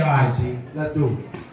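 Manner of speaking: low and cool, no big deal; laid-back friend. Retold or imitated. Friend finally agrees.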